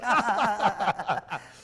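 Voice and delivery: big high laugh; loud burst of laughter. Real, not acted. Laughing at own joke.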